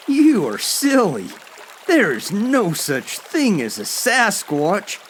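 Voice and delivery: high-pitched, lightly mocking older brother; amused scoffing. A narrator's reading. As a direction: dismiss it as silly, pitched well up. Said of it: "Steve laughed".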